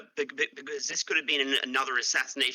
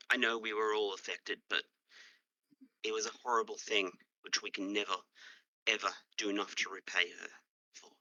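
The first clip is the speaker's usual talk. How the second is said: lower, quiet guilt; remorseful confession; 5 semitones lower, 5 dB quieter than usual. Acted. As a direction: admit a terrible wrong. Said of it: "roleplay this".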